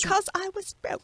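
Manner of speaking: high, soft copy of the catchphrase; a hint of a mocking copy; imitating catchphrase. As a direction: try out his tagline. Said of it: "No, I'm trying to do it".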